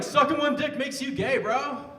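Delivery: loud, high protest; defensive retort. Acted. Comic imagining himself arguing back, loud defensive protest. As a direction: push it up loud and high, half in mock outrage.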